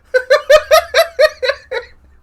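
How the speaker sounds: very high squealing laugh; shrieking laugh. Real, not acted. Laughing at the game.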